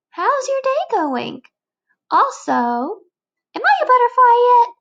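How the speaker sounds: put-on high, loud eager kid voice; a fidgety bug asking too soon; squeaky demanding caterpillar; impatient caterpillar.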